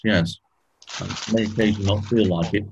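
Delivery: usual speaking voice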